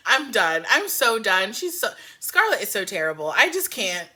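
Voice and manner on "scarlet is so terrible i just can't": ordinary talk, nothing marked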